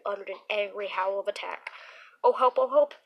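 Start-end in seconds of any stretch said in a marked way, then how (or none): 2.2-2.9 loud frightened cry; panicked cry for help. Acted. Donnie crying out as the monster nears. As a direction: shout it in sheer terror.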